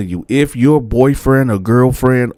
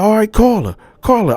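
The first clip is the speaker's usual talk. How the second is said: imitating a furious girlfriend; angry girlfriend; 12 semitones higher than usual. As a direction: confront him over the photos. Girlfriend demands a call.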